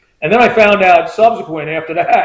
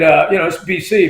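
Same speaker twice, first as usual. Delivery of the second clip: ordinary talk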